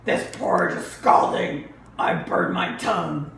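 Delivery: loud, gruff complaining bear; big bear's pained complaint; papa bear. Acted. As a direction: grumble about the hot porridge. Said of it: "Papa Bear".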